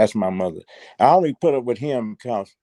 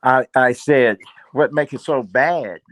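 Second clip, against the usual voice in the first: louder, repeating it for the listener; speaking up; 3 semitones higher, 6 dB louder than usual. Real, not acted. Guest repeats himself after host asks him to speak up, louder. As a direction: raise the volume to be heard. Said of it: "Can you speak up just a little".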